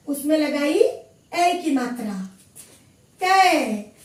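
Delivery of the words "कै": कै said loudly with the long ai sound; a teacher stressing कै with the ai vowel; clear loud demo. Shown as a demonstration. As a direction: call out कै clearly for the class. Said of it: "इसका उच्चारण होगा".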